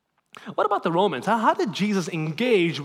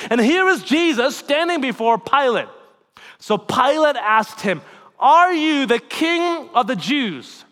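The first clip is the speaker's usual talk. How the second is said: raised, impassioned sermon delivery; preaching at full force; 8 semitones higher, 9 dB louder than usual. Acted. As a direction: preach it out to the congregation.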